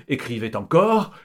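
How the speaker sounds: ordinary delivery, nothing marked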